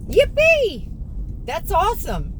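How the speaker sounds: high, loud whoop of delight; gleeful cheer. Real, not acted. Celebrating good news.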